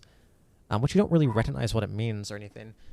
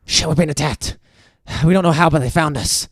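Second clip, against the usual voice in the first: louder, tense hurried reply; urgent sailor; 3 semitones higher, 7 dB louder than usual. Acted. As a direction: blurt it out under attack. Panicked sailor answering player.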